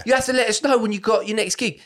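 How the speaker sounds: lightly hammed pushy admirer; mock eager fan. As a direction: fan demanding to be told about the next gig. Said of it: "drunk fan quoted".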